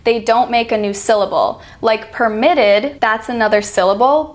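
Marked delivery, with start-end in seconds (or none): none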